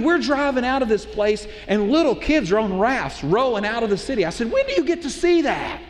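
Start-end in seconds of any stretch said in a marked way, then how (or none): 4.5-5.8 high-pitched, thrilled exclamation; retold excitement. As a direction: relive the thrill, voice lifted high. Retelling his excitement in the hurricane.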